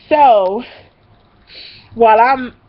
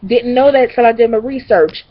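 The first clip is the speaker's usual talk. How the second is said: ordinary talk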